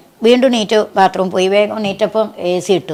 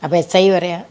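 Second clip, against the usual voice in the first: ordinary talk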